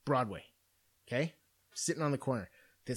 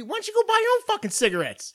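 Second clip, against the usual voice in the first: higher, louder, fast exasperated snap; a fed-up comeback to the beggar, much higher and faster; indignant retort; 6 dB louder, faster than usual. Retold or imitated. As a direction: fire it back at him in outrage. Indignant imagined retort to panhandler.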